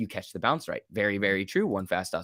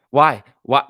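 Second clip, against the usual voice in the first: loud, insistent why to the chat; 3 semitones higher, 10 dB louder than usual. Real, not acted. Streamer to chat doubting him, loud insistent why. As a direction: demand an answer, loud.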